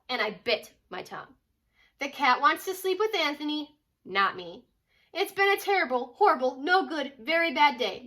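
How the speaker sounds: put-on storybook read-aloud voice for kids; reading to children. Mom reading a picture book to her kids, storytelling voice. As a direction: tell it like a bedtime picture book.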